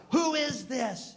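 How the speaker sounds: high, slow and baffled; slow, high, half-asleep demand; startled who is this. Retold or imitated. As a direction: drag it out, confused and woken up. Demanding caller's name at 4 a.m.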